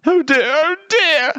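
high, slow dismay; high-pitched woeful bear; fretting polar bear. A narrator's reading. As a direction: moan it high and drawn out. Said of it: "said the polar bear as she stood up".

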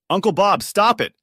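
high, loud, urgent shout. A narrator's reading. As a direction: stop him, shouting. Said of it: "I shouted".

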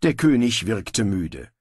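ordinary delivery, nothing marked

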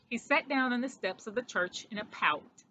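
ordinary delivery

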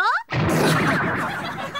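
high, airy laugh of amusement; breathy laugh. Acted. Teacher laughs as she recognises the would-be comedian, teasing.

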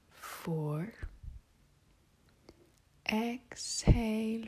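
breath count stretched out with longer pauses; slow the count, leaving long gaps between numbers. Shown as a demonstration. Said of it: "And slower".

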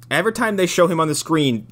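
ordinary talk, nothing marked

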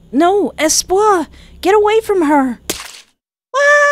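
high, loud, drawn-out cry; desperate shout. Acted. Sonny shouts as the ghost grabs Espoir, high and desperate. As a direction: cry out to save her.

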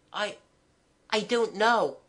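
put-on high, hesitant child's voice; timid. Child unable to name the city, timid. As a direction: falter shyly after the first word. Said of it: "I replied timidly".

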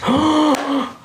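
sharp high gasp of delight; excited gasp. Real, not acted. Vlogger hearing a viewer eats hot Cheetos, gasps in delight. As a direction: gasp like you just learned something big.

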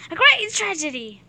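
hammed-up grief over a mirror; melodramatic. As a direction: wail it like a stage tragedy. Mock dramatic lament.

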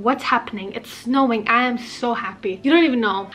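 ordinary delivery

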